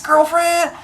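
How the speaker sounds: put-on high, sassy girlfriend; a scandalized friend. Crowd reacting to Arachne with high sass.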